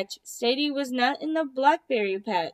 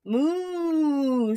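mock moo; 2 semitones higher than usual. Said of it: "said Hen".

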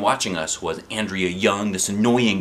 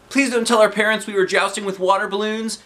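put-on high pleading kid; begging; 12 semitones higher, 4 dB louder than usual. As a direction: beg him not to tell. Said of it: "begged Neil".

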